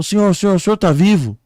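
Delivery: high, loud alarmed questioner; rescuer calling out. Acted. Man calling to a crash victim, urgent and loud. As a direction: call urgently to an injured stranger. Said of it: "o homem chegou pra ele e falou".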